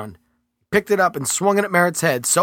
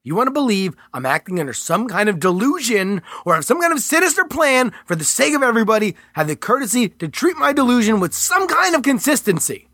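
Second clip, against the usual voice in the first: raised, tense protest; Mitchell's character voice; 8 semitones higher than usual. Acted. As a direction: argue back, higher and pressed. Mitchell arguing with agent.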